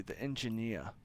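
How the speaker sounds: ordinary talk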